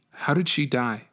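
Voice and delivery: plain reading voice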